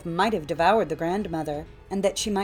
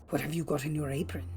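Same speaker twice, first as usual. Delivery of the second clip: low, soft, quick wolf voice; hushed wolf, quick and low; prying wolf; 4 semitones lower, 7 dB quieter, faster than usual. A narrator's reading. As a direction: probe quietly as the sly wolf. Wolf questions the girl.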